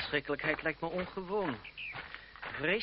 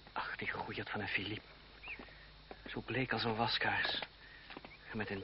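soft whispered pity; whispered aside; 6 semitones lower, 8 dB quieter than usual. Acted. André to himself about Philippe, whispered. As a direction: murmur it under your breath.

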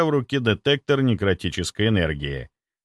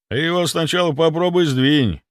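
higher-pitched, scoffing retort; snorting scoff; 6 semitones higher, 3 dB louder than usual. A narrator's reading. Zanozin answering his partner about moving the cabinet, mild scoff. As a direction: scoff lightly at the suggestion. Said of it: "фыркнул Занозин".

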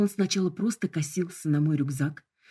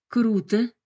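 impressed; 5 semitones higher, 5 dB louder than usual. Acted. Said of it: "вынуждена была признать я".

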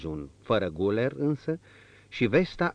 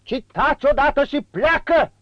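1 furious shout; 11 dB louder than usual. A narrator's reading. Marquis orders servant out.

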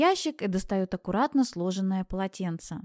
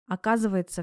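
plain reading voice

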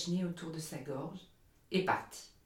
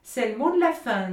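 much higher and louder, delighted; excited linnet; 6 semitones higher, 10 dB louder than usual. Acted. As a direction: chirp it out in delight. Linnet exclaims approvingly.

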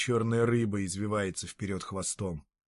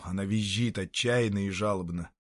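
ordinary delivery, nothing marked

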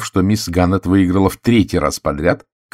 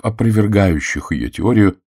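ordinary delivery

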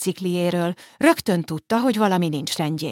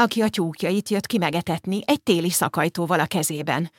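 usual speaking voice

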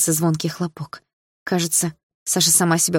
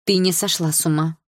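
usual speaking voice

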